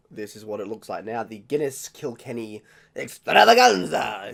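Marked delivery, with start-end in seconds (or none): 1.2-4.3 big, loud, drawn-out showman's call; mock grand announcement. Drinker unveils the Guinness Kilkenny mix, mock grand announcement. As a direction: stretch it out slowly and proclaim it.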